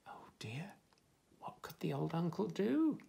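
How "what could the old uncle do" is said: plain reading voice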